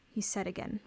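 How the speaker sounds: ordinary delivery, nothing marked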